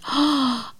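sharp, high intake of breath; astonished gasp. A narrator's reading. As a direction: gasp in amazement. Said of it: "she gasped".

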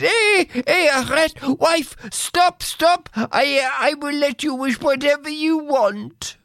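high, urgent husband calling stop; shout. Acted. Husband stopping his wife at the window, urgent shout. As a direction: cry out to stop her.

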